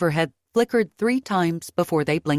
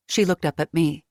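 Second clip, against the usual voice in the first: usual speaking voice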